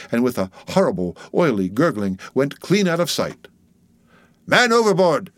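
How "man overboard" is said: high-pitched urgent call; cried alarm. A narrator's reading. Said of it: "cried Daggoo".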